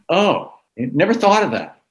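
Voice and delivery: a mock dawning "oh" of realization. Young reporter hit by the businessman's answer, mock surprised realization. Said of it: "like, going".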